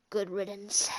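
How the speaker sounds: low, soft brooding emo line. Pretend. Vlogger quoting the evil Peter in an emo voice. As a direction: growl it like a moody emo villain. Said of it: "my voice is cracking when I do, e-, like, emo voices".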